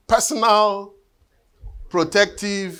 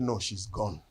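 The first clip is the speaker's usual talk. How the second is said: hushed reply; 7 semitones lower, 12 dB quieter than usual. Retold or imitated. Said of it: "They said".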